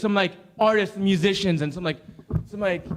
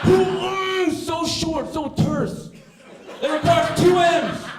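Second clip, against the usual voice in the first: higher, louder rant about a tiny word; comic rant; 10 semitones higher, 5 dB louder, much slower than usual. Acted. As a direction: rail against it, building up.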